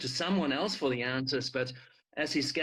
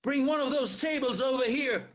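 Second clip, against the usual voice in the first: higher, raised voice giving orders; shouted order; 11 semitones higher, 3 dB louder than usual. Acted. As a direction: call across the room for help. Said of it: "Theo shouted".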